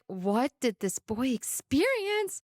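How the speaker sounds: high, drawn-out dread; retold mock horror. Mom wondering what happened to her son, mock horror. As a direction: stretch it out in alarmed disbelief. Mother voices her horrified reaction.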